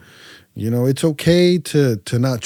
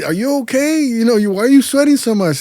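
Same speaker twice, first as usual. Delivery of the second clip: concerned question; 10 semitones higher, 5 dB louder than usual. Retold or imitated. People quoted asking about sweat.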